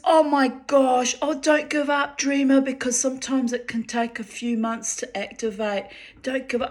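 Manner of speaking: high, urgent friend voice urging her on; encouraging friend. Retold or imitated. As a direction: cheer her on in a high pleading voice.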